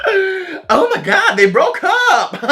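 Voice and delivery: high, slow gasping mock shock. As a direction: gasp it out like juicy gossip. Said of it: "everyone was like".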